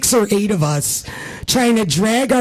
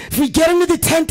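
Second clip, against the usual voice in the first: rallying plea, pushed harder; 10 semitones higher, faster than usual. Retold or imitated. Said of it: "rallying the troops".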